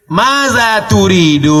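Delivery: "Maza turidu" slow and high; formal Arabic. Shown as a demonstration. Preacher to students, slow formal Arabic. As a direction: say "Maza turidu" deliberately, like a teacher. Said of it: "Maza, bahasa Arabnya".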